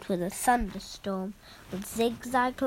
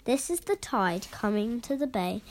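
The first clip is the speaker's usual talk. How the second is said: usual speaking voice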